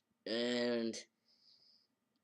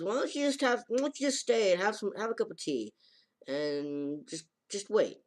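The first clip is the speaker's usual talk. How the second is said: put-on coaxing girl voice; 8 semitones higher than usual. Girl coaxes him to stay.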